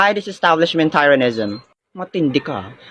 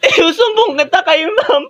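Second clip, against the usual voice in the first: crying; 10 semitones higher than usual. Acted. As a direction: bawl it out as a hurt tattletale. Hurt child cries after being hit. (umiiyak na nagsusumbong)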